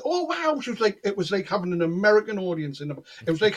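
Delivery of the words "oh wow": high-pitched imitation of an overawed onlooker; wowed, voice shooting up; mouthy amazement. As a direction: mimic a gushing audience member. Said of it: "she was shouting her mouth".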